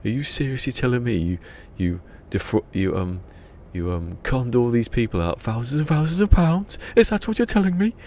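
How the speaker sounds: mock-outraged priest rising to a high squeal; incredulous priest. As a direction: start stern, climb into outraged disbelief. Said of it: "he goes".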